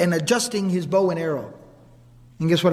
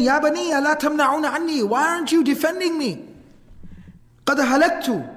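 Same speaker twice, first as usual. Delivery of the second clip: retold screaming plea; 6 semitones higher than usual. Dying man pleads with his children, retold screaming plea. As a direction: imitate a desperate man's cry without shouting. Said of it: "And he keeps on screaming".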